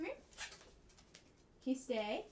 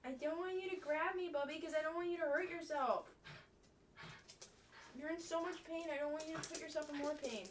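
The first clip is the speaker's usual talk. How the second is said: fast pleading; 3 semitones higher, 4 dB quieter, faster than usual. Real, not acted.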